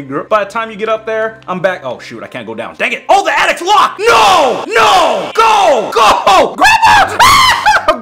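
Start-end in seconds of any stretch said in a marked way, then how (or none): 3.1-8.0 loud, high panicked yells; panicked shouting. Real, not acted. Gamer trapped by grandma, panicked yelling. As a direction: scream for your life as she corners you.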